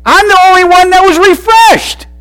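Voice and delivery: the son outraged at an unfair sentence; indignant disbelief. Retold or imitated. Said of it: "In a sense saying".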